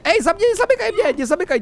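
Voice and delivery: very high startled cry; high-pitched burst of panic; alarmed squeal. Real, not acted. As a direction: squeal not to be shut in. Afraid of being shut in.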